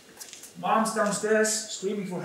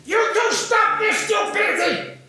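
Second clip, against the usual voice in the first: put-on high, loud shouted command from a five-year-old; small boy shouting at his fighting parents; child's shout; 11 semitones higher, 5 dB louder than usual. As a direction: shout it to stop the fight, big and brave. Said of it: "this is what I say".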